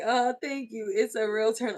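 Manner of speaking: ordinary talk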